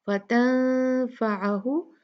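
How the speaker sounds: फ़तंफ़अहु with a muffled nasal n; ikhfa, as before. Shown as a demonstration. Teacher recalling an earlier ikhfa example, slow. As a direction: hide the n of फ़तंफ़अहु in a nasal glide. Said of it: "जैसे हमने यहाँ पढ़ा था".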